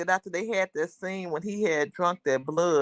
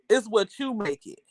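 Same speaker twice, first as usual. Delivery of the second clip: normal talking voice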